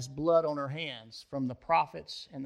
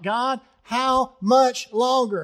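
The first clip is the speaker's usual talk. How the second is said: higher, louder, slow pleading cry; 8 semitones higher, 7 dB louder, slower than usual. Retold or imitated. Martyred souls crying out to God, high and slow. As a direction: drag out the plea to God. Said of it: "they're crying out".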